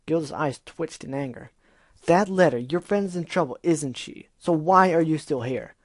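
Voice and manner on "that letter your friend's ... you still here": loud, high insistent questioning; angry demand. Acted. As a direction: press her hard.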